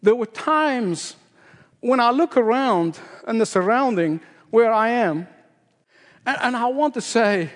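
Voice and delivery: ordinary delivery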